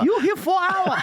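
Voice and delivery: very high shouted mock-accent complaint; John Panette. Restaurant worker scolds lingering diner, high shouted mock accent. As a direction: shriek it as the routine's restaurant worker. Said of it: "Panette's routine about, uh, going to a Chinese restaurant".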